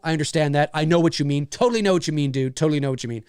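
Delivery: usual speaking voice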